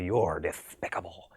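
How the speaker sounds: slow, lisping cartoon duck voice; Daffy Duck lisp. Actor showing how a lisp gets you halfway to the character, slow lisping duck voice. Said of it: "hang on to a character like Daffy Duck by saying that he's got a lisp".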